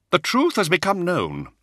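high, loud, grim announcement. A narrator's reading. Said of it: "Israfel said grimly".

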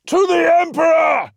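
battle cry, high and loud; screamed order. A narrator's reading. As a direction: scream the command to charge. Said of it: "Ra screamed the order".

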